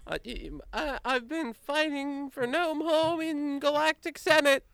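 very high, squeaky nervous gnome voice; anxious little gnome. Acted. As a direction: stammer in a tiny high gnome voice. Gnome answers Molly.

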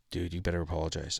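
low, soft, quick aside; hushed, imitated cousin; discreet advice. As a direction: quietly urge your cousin. Cousin quietly advises him.

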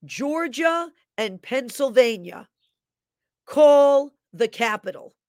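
a prophetic voice booming out a slow command; retold yell. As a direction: call it out like the voice in the dream, rising to a cry. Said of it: "this voice yelled".

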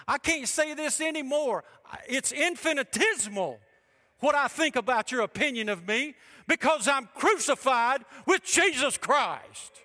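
high, loud shout of conviction, slowing on the last words; preaching shout. Acted. Preacher voicing Paul to the congregation, shouted high and loud. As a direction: proclaim it like the apostle Paul.

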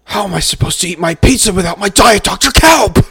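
high, loud, outraged shout; angry shout. Acted. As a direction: yell it like a furious customer. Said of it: "I have to do it justice".

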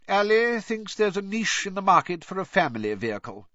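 lightly voiced stern bureaucrat; stern official.

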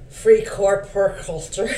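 slow attempt at a German accent. Shown as a demonstration. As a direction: try out a shaky German accent. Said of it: "I'm gonna work on my German accent".

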